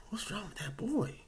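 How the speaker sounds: high, nosy mock bystander voice; gossiping onlookers. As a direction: play the judging strangers in line. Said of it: "they looking at me like".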